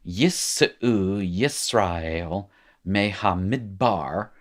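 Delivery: slow, measured Hebrew reading accent; own Hebrew accent. Shown as a demonstration. Teacher reads exercise aloud for students, slow accented Hebrew. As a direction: read slowly in careful learner's Hebrew. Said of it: "Here's my accent".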